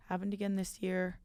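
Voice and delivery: soft sigh of resignation; quiet repeat. Real, not acted.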